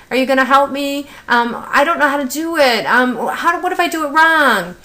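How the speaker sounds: high-pitched, fretful student voice; imitating worried Jason. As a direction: mimic an anxious pupil pleading for reassurance. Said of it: "begins to repeat concerns about his ability".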